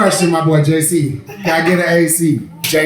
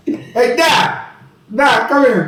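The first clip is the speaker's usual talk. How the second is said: God calling out laughing; 10 semitones higher than usual. Acted. God calling the doctor to gossip, high laughing shout. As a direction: shout across heaven, cracking up.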